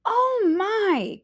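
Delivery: high startled cry; exclaimed. A narrator's reading. Cassie shocked by the crashing lamp, crying out. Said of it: "Cassie exclaimed".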